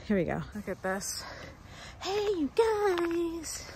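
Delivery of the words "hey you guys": high, long-drawn holler; film catchphrase call. Acted. Fan quoting the film at its location, drawn-out call. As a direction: stretch each word out high like a call.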